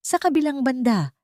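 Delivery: ordinary delivery, nothing marked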